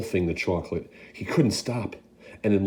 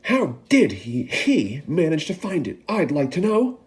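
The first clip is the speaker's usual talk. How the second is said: angry shout; 8 semitones higher than usual. Acted. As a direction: demand an answer. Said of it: "a large boy shouted angrily".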